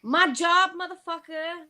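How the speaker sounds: high, exasperated snap back. Real, not acted. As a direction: fire it back, fed up. Snapping back at question.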